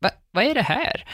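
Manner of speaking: high-pitched baffled stammer; imitated astonishment. Mum meeting the surprise guests, high mock astonishment. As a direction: stumble into a high, disbelieving question. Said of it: "hon var liksom den första som bara".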